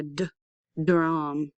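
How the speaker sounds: short d, pause, then drum; sounded-out onset. Shown as a demonstration. Teacher tests the first sound, d apart from drum. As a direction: tap out the d alone before drum. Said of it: "Do you hear n".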